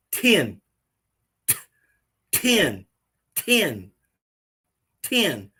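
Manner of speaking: "ten" with the tongue tip pressed to the roof of the mouth; ten demo. Shown as a demonstration. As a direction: say "ten" crisply, tongue up behind the teeth, repeated slowly. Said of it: "and you can say".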